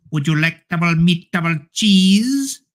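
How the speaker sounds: put-on high, stretched Indian accent; mock Indian sandwich-server accent, drawing out the last word. Retelling a Subway worker's sandwich question in an exaggerated Indian accent. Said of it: "they hired this Indian guy".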